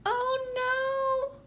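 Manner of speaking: high, alarmed cry in a character voice. A narrator's reading. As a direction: squeal it in panic. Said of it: "I cried out".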